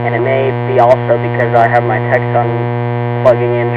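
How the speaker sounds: loud, boxy sound through a CB radio on AM with hum; talking into a CB mic, heard over the AM transmission. Real, not acted. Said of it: "it sounds like I'm in A M mode because I am".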